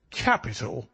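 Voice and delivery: mildly excited exclamation; delighted cry. A narrator's reading. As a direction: repeat it with a touch of delight. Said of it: "cried the inspector".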